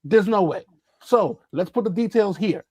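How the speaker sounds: ordinary talk, nothing marked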